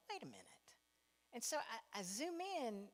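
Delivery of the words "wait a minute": quiet realization. Retold or imitated. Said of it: "I'm like".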